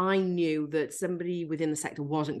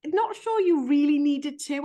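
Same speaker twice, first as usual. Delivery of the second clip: teasing; 11 semitones higher, 6 dB louder than usual. Real, not acted. Teasing aside to the host.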